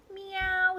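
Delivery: high cat meow. A narrator's reading. Cat answers with a high meow. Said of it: "said Cat".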